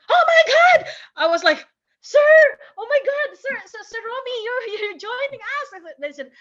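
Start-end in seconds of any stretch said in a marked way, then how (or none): 0.1-0.8 loud, high-pitched exclamation of disbelief; starstruck shock. Retold or imitated. Fan spotting Sir Romy at breakfast, starstruck shriek. 2.0-5.7 high-pitched, flustered fan greeting, loud at first; starstruck excitement. Retold or imitated. Fan to Sir Romy joining her table, flustered excitement. Retells being starstruck as he joins.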